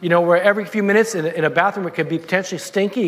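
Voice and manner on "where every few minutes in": ordinary talk, nothing marked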